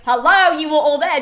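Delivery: loud, exasperated "hello" at the gods; mock-outraged, calling them all out; indignant Hera.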